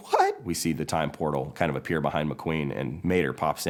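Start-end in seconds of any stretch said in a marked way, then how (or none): 0.1-0.4 very high startled yelp; shocked what. Acted. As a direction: blurt it in disbelief. McQueen shocked.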